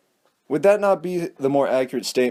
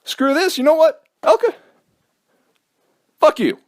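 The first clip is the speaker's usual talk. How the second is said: put-on whiny teenage comeback, higher; teen back-talk; 8 semitones higher than usual. Grounded teen mouthing off to his stepmom, higher and whiny. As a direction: sulk and snap back like a teenager. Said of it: "I'd say".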